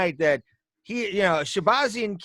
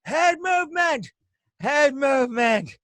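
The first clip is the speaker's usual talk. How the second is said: high, far-carrying shout of the coach's instruction; coach yelling; 10 semitones higher, 4 dB louder than usual. Retold or imitated. Coach shouting instructions to his fighter from the corner, high and loud. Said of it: "he kept yelling to Ronda Rousey".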